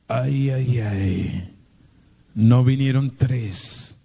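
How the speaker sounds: ordinary delivery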